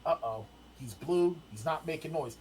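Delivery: softly imitated dawning concern; nervous new dad. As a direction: recall quiet worry, dropping the volume. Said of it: "I'm getting nervous".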